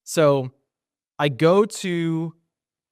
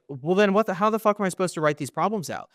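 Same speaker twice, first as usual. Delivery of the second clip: higher-pitched, indignant retold complaint; exasperated protest; 6 semitones higher than usual. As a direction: argue back in disbelief, pitch raised. Argues after pencil is refused.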